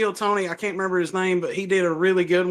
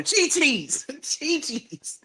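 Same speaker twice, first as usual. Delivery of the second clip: mock holler; 7 semitones higher, much slower than usual. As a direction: playfully call it out high, laughing. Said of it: "just run around and just holler".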